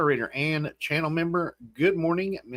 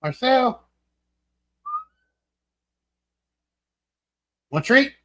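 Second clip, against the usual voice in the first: calling the dog; 10 semitones higher, 4 dB louder than usual. Real, not acted.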